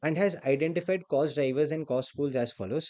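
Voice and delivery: reading the problem out slowly; deliberate exam-question read. Acted. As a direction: dictate the question at a slow pace. Reads question text aloud.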